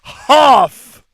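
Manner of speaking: "Hoff" shouted high with an exaggerated vowel; mimicked pronunciation. Teasing a friend over "have", loud mock "Hoff".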